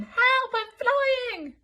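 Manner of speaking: high, loud distant cry; calling for help. Acted. Elmer calling for help from the air. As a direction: shout from far away. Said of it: "The voice came again".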